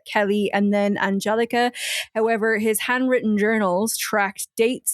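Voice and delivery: ordinary talk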